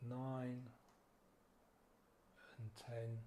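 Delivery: slow, soft counting with long pauses between breaths; guided breath count. Acted. Meditation guide counting listeners through slow breaths, soft and unhurried. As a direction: count each breath gently, letting it settle.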